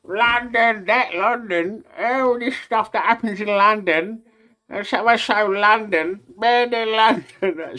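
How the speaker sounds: high, drawn-out mock gushing about London; high mocking sing-song impression of a London-obsessed outsider; mocking the out-of-towner. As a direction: parody a visitor who won't stop going on about London. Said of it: "the man then trying to come and tell us about".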